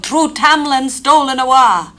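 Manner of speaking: high, loud wail of the Elf Queen; crying out. Acted. Tamlin mimics the Elf Queen's loud cry. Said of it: "the Queen of Elfland will cry out".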